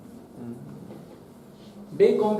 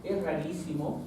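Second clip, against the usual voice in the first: soft repeated emphasis; 2 semitones lower, 10 dB quieter than usual. Real, not acted. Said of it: "les vuelvo a reiterar".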